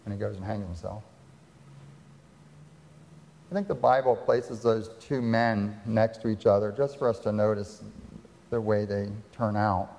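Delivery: ordinary talk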